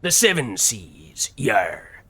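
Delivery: hearty pirate arr. Acted. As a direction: growl it like a pirate. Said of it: "why I was using a pirate voice".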